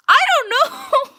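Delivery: very high, loud, stretched-out yell; exasperated shout. Real, not acted. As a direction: wail it at full voice. Panics over inches and centimeters question.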